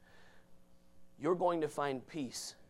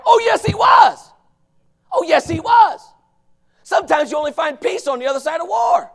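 loud, high, insistent yell; emphatic shout; 19 dB louder than usual. Real, not acted. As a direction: shout the answer down. Preacher shouting back.

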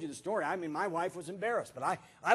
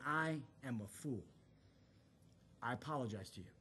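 very low, hushed admission; humbled apology; 10 semitones lower, 14 dB quieter than usual. Retold or imitated. Pastor apologizing to the man he threatened, hushed and contrite. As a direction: lower the voice and confess softly.

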